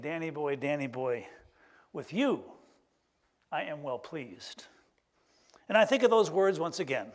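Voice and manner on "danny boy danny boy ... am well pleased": slow and soft to the end; gentle blessing. Real, not acted. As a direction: bless him softly, slowing down. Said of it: "I heard my voice saying".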